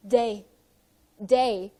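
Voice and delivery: day said as Fujian "day", clear unaspirated d-like t and ay vowel; Fujian dialect te. Shown as a demonstration. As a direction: say day the Fukienese way for tea. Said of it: "the T was pronounced as".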